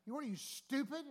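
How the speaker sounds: imitated indignant outburst; mock angry insult. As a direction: act out an irate shopper's jab. Replaying the woman's earlier insult.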